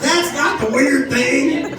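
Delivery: high, slow comic objection; drawn-out mock protest. Real, not acted. Repeats protest, then normal take follows.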